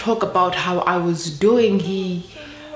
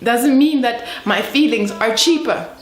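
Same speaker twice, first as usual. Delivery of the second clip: higher-pitched, impassioned self-defence; indignant protest; 6 semitones higher, 4 dB louder than usual. Acted. Woman insisting her feelings count as much as a man's, indignant. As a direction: protest heatedly, pitch climbing.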